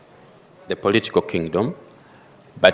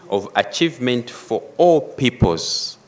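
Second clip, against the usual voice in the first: "all" raised and held, falling on "peoples"; stress on all peoples; 4 semitones higher than usual. Shown as a demonstration. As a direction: lift "all" high in "all peoples". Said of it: "emphasis all peoples".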